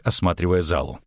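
ordinary delivery, nothing marked

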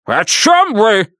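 high, drawn-out indignant bark; exasperated, high-pitched outburst; barked question. A narrator's reading. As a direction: snap it out in outraged disbelief. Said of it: "рявкнул он".

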